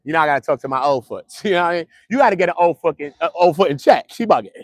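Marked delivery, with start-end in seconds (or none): none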